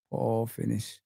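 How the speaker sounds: soft, low "Poor finish" to himself; muttered repeat. Shown as a demonstration.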